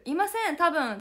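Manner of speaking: high, loud playful fib that trails into a shrug; mock loud fib with a shrugged maybe; cheeky denial. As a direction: deny it brightly then hedge. Member fibbing, adding maybe.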